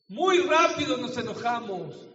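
loud, high emphatic warning. Real, not acted. Preacher warning the congregation about quick anger, loud. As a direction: drive it home loud.

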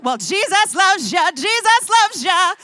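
high, slow sing-song blessing; sing-song grandma. Retold or imitated. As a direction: croon it like a cheery old lady. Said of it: "and say".

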